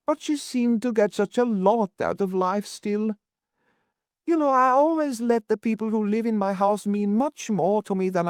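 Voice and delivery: high-pitched, tearful lament; distressed Mrs Worth, mournful. A narrator's reading. Landlady mourning a lodger she grew fond of, high lament. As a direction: keep it high and sorrowful, in the landlady's voice.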